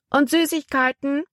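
put-on high-pitched small boy's question; little boy. A narrator's reading. Small boy asking about sweets, high child's voice. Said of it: "wollte ein kleiner Junge wissen".

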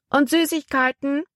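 put-on high, eager little boy's voice; a small kid hoping for sweets. A narrator's reading. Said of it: "wollte ein kleiner Junge wissen". (hohe, eifrige Kleinjungenstimme)

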